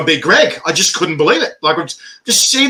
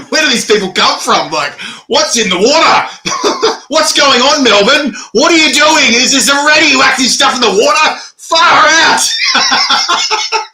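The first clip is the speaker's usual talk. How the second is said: high, loud, laughing disbelief; mock-incredulous rant; 10 semitones higher, 4 dB louder, slower than usual. Host recalls baffling chilli champions from Melbourne, mock-incredulous shouting. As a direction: cry out in hammed-up bewilderment, pitch climbing.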